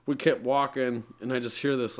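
ordinary talk, nothing marked

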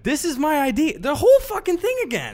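high, loud exasperated mock outburst; high, loud mock exasperation. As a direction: throw up your hands, voice rising.

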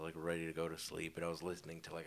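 ordinary talk

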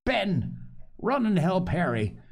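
high, outraged exclamation; crying out. A narrator's reading. Silver hears of a buccaneer in his house, crying out. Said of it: "cried Silver".

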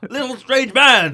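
booming slow Drax yell; Drax shouting. Retold or imitated. Drax spots an elf and shouts at it, slow and booming. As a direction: bellow it slowly, amazed at the tiny man. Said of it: "he sees the elf and he goes".

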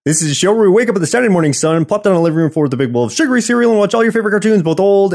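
fast rushed intro spiel; speeding up. Real, not acted. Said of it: "I'm trying to say it faster and faster".

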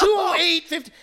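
high, loud finishing time; re-enacting a race announcement; shouted result. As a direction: call out the time excitedly. Retold shout of the final result.

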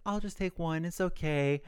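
put-on soft polite little-boy refusal; Weston declining. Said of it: "It's like".

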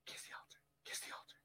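hushed, voiceless stage prompt; imitated whisper. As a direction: whisper a discreet cue to a colleague. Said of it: "He's whispering".

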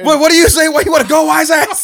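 very high, very loud, laughing mock tough guy; tough-guy challenge. The cab driver picks a fight, hammed-up tough guy.

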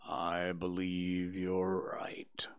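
low, slow, whispered and mournful; gloomy donkey Eeyore. Acted. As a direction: sigh it out like a sad old donkey.

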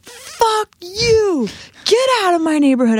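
retold high-pitched fury; losing it. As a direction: act out totally losing it on someone. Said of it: "just all of a sudden lost it on her".

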